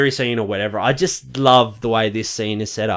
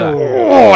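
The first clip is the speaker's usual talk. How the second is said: high, loud squirm of disgust; cringing groan; 6 dB louder than usual. Shown as a demonstration. Showing how the ankle scene affects him, cringing groan. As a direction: groan as if unable to watch. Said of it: "it makes me really fucking cringe".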